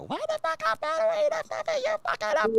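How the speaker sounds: high yappy squeaky voice; chihuahua voice. Retold or imitated. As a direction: yap it like a tiny angry dog. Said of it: "like a chihuahua".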